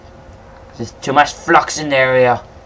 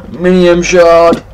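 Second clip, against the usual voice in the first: very loud, slow drawn-out exclaim; amazed cry; 6 semitones higher, 9 dB louder, much slower than usual. Real, not acted. Gamer thrilled at finding a rare shard, loud and slow. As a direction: stretch it out in awe. Gasping at loot find.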